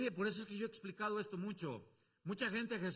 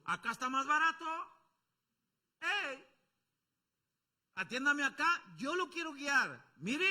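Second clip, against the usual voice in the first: loud, higher hawker's call; market vendor calling; 8 semitones higher, 6 dB louder than usual. Acted. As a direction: hawk your wares at the market. (pregonero de mercado, fuerte y agudo)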